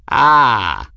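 put-on loud, sharp old man's cackle. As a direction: bark out a gleeful cackle. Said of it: "Ferris cackled".

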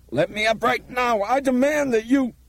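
very high, strident demand; frantic high shout, cut off. A narrator's reading. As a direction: yell it as an outraged demand. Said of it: "Craig shouted".

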